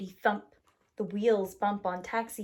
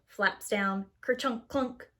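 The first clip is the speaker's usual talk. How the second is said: ordinary delivery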